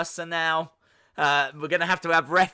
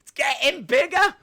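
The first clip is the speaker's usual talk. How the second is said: more excited; 8 semitones higher, 5 dB louder than usual. Real, not acted. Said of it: "repeated higher".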